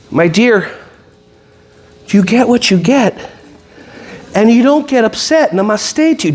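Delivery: calm, gentle zen teacher; mock serene teacher. Shown as a demonstration. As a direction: soothe the kid like a yoga teacher. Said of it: "Do you say it like that?".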